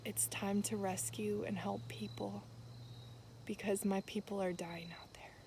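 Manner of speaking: softly retelling a holy whisper; God's hushed words. Retold or imitated. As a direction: speak it gently, as if God is whispering to her.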